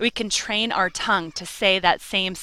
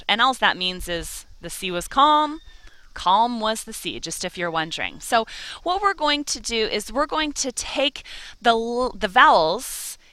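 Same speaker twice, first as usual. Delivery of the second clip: usual speaking voice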